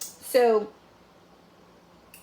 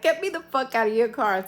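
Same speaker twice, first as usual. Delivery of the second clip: angry demand; 4 semitones higher, 7 dB louder than usual. Retold or imitated. Angry demand to her date.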